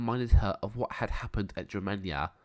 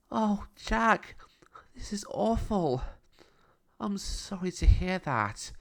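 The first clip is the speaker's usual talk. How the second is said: put-on higher, slower girl's voice full of sympathy; Nina's voice; 11 semitones higher, slower than usual. A narrator's reading. Nina answering Jack's sad news, sympathetic girl's voice. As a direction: pitch up into a young girl, gently sorry.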